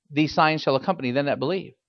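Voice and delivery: ordinary talk, nothing marked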